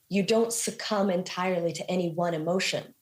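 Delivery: ordinary delivery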